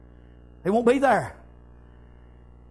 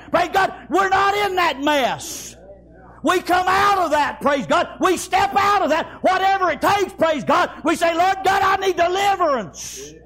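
fiery preaching; 5 semitones higher than usual. Acted. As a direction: shout the deliverance to the church. Preaching at full force.

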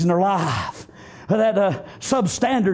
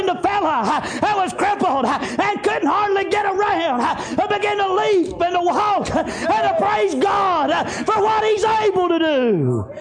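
high, driving revival preaching, gasped breaks between phrases; full-force preaching. Acted. Preacher retelling Jesus healing a crippled man, full-force preaching. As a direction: preach it out in shouted, rhythmic bursts.

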